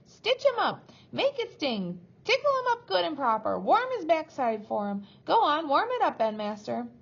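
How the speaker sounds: high, loud, relentless screech; frenzied piercing cheering-on; shrieking. A narrator's reading. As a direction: shriek to egg on the caning. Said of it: "shrieked Missus Cratchit".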